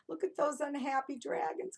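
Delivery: ordinary talk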